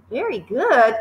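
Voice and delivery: ordinary delivery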